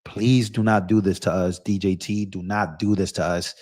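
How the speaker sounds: low, soft, weary plea; hushed, low-pitched begging; quiet pleading. Acted. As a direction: plead under your breath, voice sunk low. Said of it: "you are looking around like".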